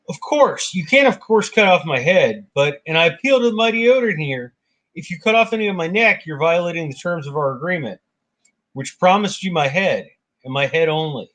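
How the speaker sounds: high-pitched lawyerly objection; Loki as lawyer. Acted. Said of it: "he's also quite the lawyer".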